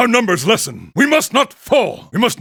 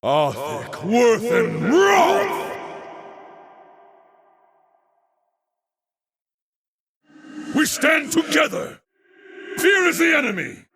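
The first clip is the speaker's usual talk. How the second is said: ritual battle invocation. Acted. Chanting invocations before battle.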